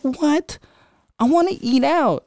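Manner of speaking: high-pitched mock indignant whine; put-on high voice of an annoyed sibling; pissed sister. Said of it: "my sister was pissed".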